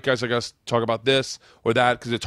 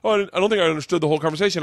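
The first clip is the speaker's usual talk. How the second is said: very fast, higher mumble; confused guest; 6 semitones higher, much faster than usual. Retold or imitated. The guest explaining himself, very fast and confused. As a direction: rattle it off, bewildered. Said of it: "he goes".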